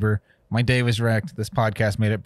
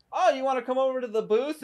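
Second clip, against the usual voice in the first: high, softer mock-friendly voice; sweet-talking clerk, lightly put on; mocking the service guy; 11 semitones higher, 5 dB quieter than usual. Retelling the clerk's invite to the booth in a mock-sweet voice.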